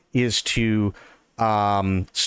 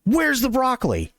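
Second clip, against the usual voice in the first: angry customer; 12 semitones higher than usual. Retold or imitated. He gets this angry tap.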